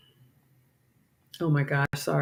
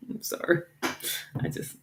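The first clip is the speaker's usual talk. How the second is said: whispered sorry; 11 dB quieter, slower than usual. Real, not acted. Embarrassed confession about animals.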